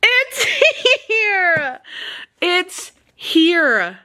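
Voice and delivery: very high laughing squeal of joy; overjoyed squeal. Real, not acted. Reader finds her awaited book delivered, overjoyed squeal. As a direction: squeal it through real laughter.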